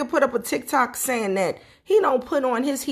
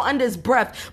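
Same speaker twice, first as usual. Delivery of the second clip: ordinary talk, nothing marked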